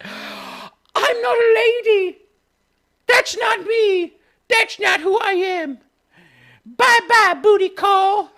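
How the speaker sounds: fake-cry it over the top; hammed-up sobbing shout. Arya rejecting Gendry, parodied.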